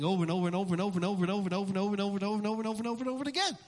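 racing, running the repeats together; breathless-style chain of fast repeats; rapid-fire repetition. Real, not acted. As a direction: rattle it off fast in one unbroken run. Hammering endless repetition in one run.

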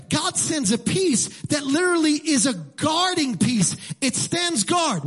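high, emphatic pulpit voice; full-force preaching. Acted. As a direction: drive each point home like a preacher.

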